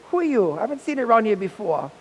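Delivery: loud, high demanding who he is; suspicious challenge. Retold or imitated. Neighbor lady loudly questioning a stranger, high and suspicious.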